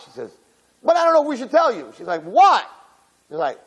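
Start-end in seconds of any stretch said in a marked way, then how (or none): 0.8-1.9 quick, high sing-it-out tease; teasing girlfriends. Retold or imitated. Bridesmaids tease the bride about a secret, coy and high. 2.2-2.6 high, punchy mock exasperation; impatient demand. Bride pesters her bridesmaids for the secret, impatient.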